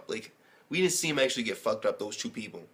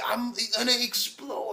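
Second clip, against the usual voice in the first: high, strained, hammed-up villain voice; mock unhinged villain; 11 semitones higher than usual. Quoting the anime villain to viewers, high and hammy. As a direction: overact a freak about to burst. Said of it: "And then he's like".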